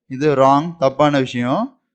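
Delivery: normal talking voice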